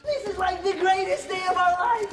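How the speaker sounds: excited shout. Acted. Thrilled yell on rocket skates.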